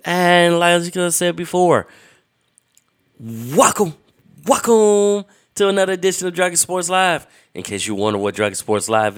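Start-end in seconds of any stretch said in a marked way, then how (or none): 0.0-7.3 big high booming welcome; hype announcer. Acted. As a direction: belt it like a ringmaster. Third take of intro.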